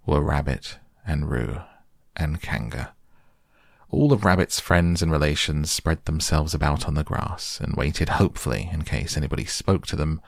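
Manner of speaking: ordinary delivery, nothing marked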